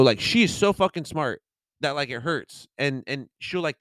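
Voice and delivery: ordinary talk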